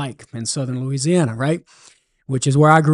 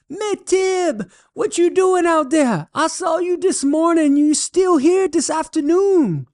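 much higher Cajun voice, puzzled call; Cajun holler; 13 semitones higher than usual. Acted. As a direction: shout the question high in a Cajun accent from the truck. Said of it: "Boudreaux hollers back".

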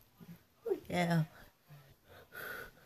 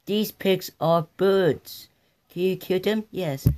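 normal talking voice

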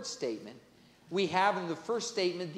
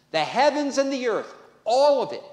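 higher and louder, proclaiming; preaching climax; 8 semitones higher, 7 dB louder than usual. Real, not acted. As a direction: swell up to the big finish of the point.

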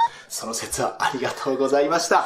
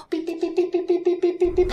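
robotic katakana speech; 13 semitones higher, much faster than usual. Acted. Computer gives its verdict.

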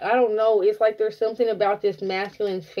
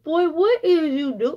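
higher, mock disbelief; a touch of surprised scolding; teasing disbelief; 7 semitones higher than usual. Real, not acted. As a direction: tease him, half laughing. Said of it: "teasing the masculine".

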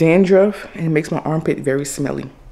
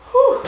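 loud whew; 4 dB louder than usual. Real, not acted. Exhale during workout.

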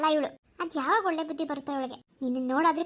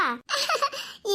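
mocking laugh; 8 semitones higher than usual. Teasing about the flimsy cot.

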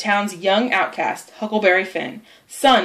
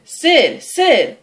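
higher, sharp wake-up call; 7 semitones higher, 4 dB louder than usual. Acted. Tom trying to wake his sleeping brother, calling out.

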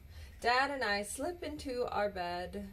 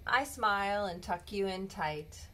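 plain reading voice

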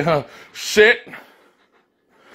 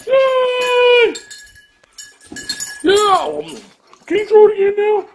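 very high, loud, fast puppy cheer; excited dog voice; 4 dB louder, slower than usual. Pretend. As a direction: squeal it like a thrilled pup.

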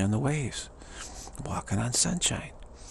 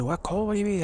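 lightly raised, incredulous pitch; as if the idea of cold were absurd; mock surprise; 10 semitones higher, faster than usual. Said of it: "I said".

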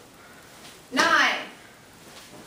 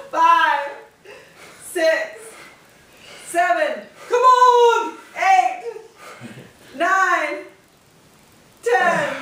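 laughing while counting reps, straining; breathless loud counting through laughter; doing push ups; 6 semitones higher, 6 dB louder, slower than usual. Real, not acted. Counting reps during exercise, laughing.

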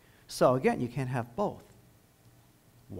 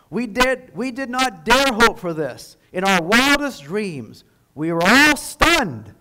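higher and much louder, slowing into awe; gloating triumph; 7 semitones higher, 12 dB louder, slower than usual. Retold or imitated. Preacher voicing Goebbels' delight for the congregation, loud gloating. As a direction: crow it, then marvel slowly. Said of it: "gloated Goebbels".

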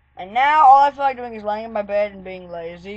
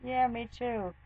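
hushed reply; 13 dB quieter than usual. Retold or imitated. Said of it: "She goes".

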